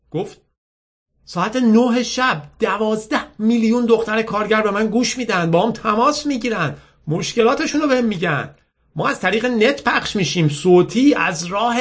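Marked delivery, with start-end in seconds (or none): 1.3-11.8 put-on high-pitched, eager girl pitching her show; enthusiastic radio girl. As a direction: sell your programme with pride. (دختری پرشور با صدای زیر)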